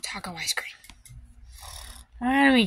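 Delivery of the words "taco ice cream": hushed whisper. Acted. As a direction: whisper it secretly. Whispered aside.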